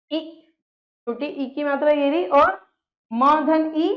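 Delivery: ordinary talk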